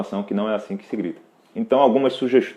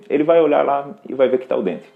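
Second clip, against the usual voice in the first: ordinary talk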